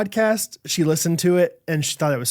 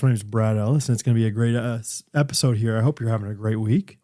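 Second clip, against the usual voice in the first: calm host; 6 semitones lower than usual. Acted. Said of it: "Host, now calm".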